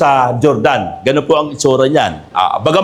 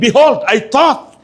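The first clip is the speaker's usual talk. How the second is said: high, slow indignant Bible reading; preacher voicing an affronted general; angry Naaman; 8 semitones higher, slower than usual. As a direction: read the complaint aloud in raised pitch, drawn out. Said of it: "Naaman was angry and".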